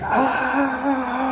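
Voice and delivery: high, loud imitated yell; imitated scream. Man retelling a stranger yelling in the street, imitated high scream. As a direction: belt out a stranger's wild scream. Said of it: "it was echoing".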